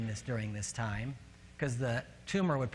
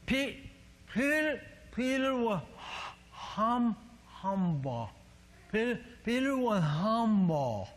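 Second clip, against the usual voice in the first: high, halting, stretched-out stuck speech; struggling to talk; 7 semitones higher, slower than usual. Retold or imitated. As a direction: imitate a mouth that won't form the words. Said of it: "I couldn't talk".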